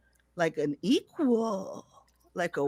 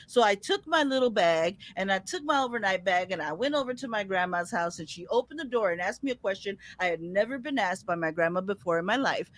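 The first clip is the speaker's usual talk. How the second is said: usual speaking voice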